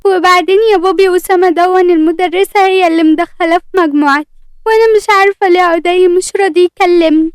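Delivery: put-on high-pitched tearful little girl; whiny, teary complaint. A narrator's reading. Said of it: "تلا بدموع".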